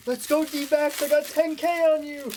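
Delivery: high, loud hammed-up yell; mock shouting. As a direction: holler it like an over-invested fan. Imitating a yelling fan, laughing after.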